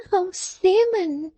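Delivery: high, slow simpering maid; high drawn-out simpering servant girl; Miggs simpering. A narrator's reading. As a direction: coo his name like a fawning maid. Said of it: "said the young lady".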